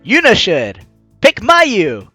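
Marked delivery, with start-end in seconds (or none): none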